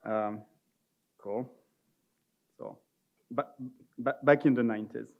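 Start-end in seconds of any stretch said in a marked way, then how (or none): none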